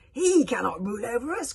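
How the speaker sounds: loud, high protest; outraged cry. A narrator's reading. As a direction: cry out in defiance. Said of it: "cried Kamikaze".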